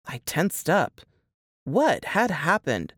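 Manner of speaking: ordinary delivery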